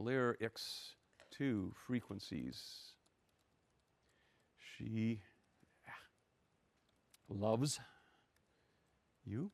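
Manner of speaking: very slow and soft, in separate words; saying while typing. Real, not acted. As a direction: mumble along as you type, long gaps between words. Retyping the command, saying each word.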